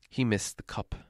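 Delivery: plain reading voice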